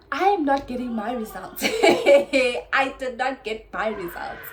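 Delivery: loud, laughing disbelief at missing results; near-shouting through laughter, exasperated; loud frustrated outburst. Real, not acted. Vlogger telling viewers her results never came, loud laughing outburst.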